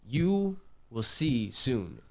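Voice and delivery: slow, ominous warning from a spirit; menacing entity. Retold or imitated. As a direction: drag each word out like a threat. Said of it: "he got really, really mad".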